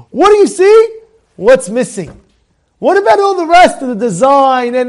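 loud, high-pitched disbelief; incredulous outburst. Acted. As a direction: demand it loudly, indignant at what was overlooked. Acts out exasperated host's disbelief.

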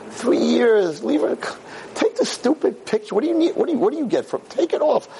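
high, urgent exasperated plea; urgent pleading. Retold or imitated. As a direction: beg her, exasperated. Rabbi begging the friend.

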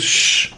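a sharp voiceless shush; annoyed shush. Retold or imitated. Sleepy passenger shushing a noisy neighbour, voiceless. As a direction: hush him without voice. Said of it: "he turns to Rabbi Yisrael and goes".

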